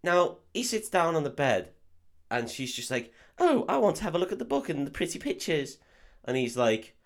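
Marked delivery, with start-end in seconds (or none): 3.4-5.8 high, girlish posh English imitation; mock-posh English accent in a high lilt. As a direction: put on a posh English girl, pitched up. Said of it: "she's incredibly posh".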